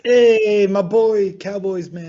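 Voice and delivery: loud, high yell of a fan; shouted fan cheer. Acted. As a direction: bellow it like a stadium fan. Reading fan comment as shout.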